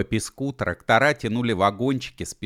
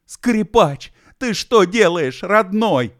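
very high, loud alarmed shout; horrified cry; 13 semitones higher, 10 dB louder, slower than usual. A narrator's reading. Bi stops the fiddler touching a balloon, horrified cry. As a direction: cry out in sudden horror. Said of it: "вдруг вскричал в ужасе".

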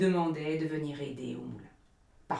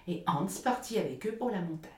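usual speaking voice

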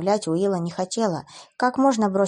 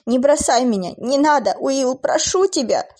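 desperate pleading; 6 semitones higher, 6 dB louder than usual. Acted. Begging dying lover.

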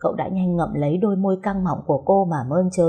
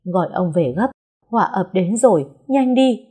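panicked urging; 3 dB louder than usual. Acted. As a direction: push him out the door in panic. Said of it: "Tay bà cả Điệp run run".

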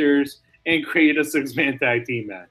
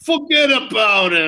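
loud, higher Brooklyn "fuhgeddaboudit"; Brooklyn accent; 6 semitones higher, 5 dB louder than usual. Pretend. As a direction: toss it off like a Brooklyn wise guy. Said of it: "That's my Brooklyn accent".

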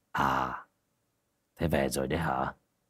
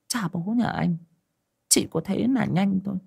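higher pitched, scolding mother voice; mother's teasing scold; 11 semitones higher than usual. Acted. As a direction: playfully chide the son like an old mother. Mother scolds son fondly.

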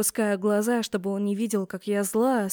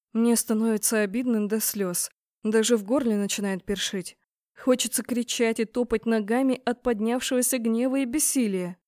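ordinary delivery, nothing marked

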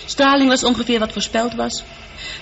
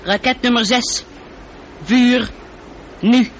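1 firing commands. Acted. Computer fires missiles countdown.

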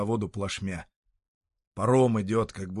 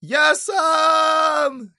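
loud, high, drawn-out holler; shout; 10 dB louder than usual. Acted. Sutyrin calling from the ferry, a long high shout. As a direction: yell it across the water. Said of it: "кричит с парома Сутырин".

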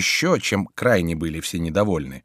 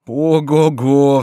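slow, loud joyful cry; glad whoop; 5 semitones higher, 7 dB louder, much slower than usual. A narrator's reading. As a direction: whoop with relief. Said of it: "вскричал Митон, обрадовавшись".

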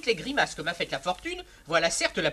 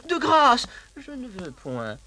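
mock coy protest; 7 semitones higher, 5 dB louder, slower than usual. Valet voices the countess protesting.

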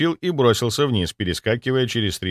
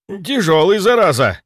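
straining exhale; 9 semitones higher, 6 dB louder than usual. Acted. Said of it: "выдохнул водитель".

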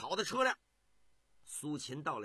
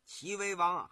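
usual speaking voice

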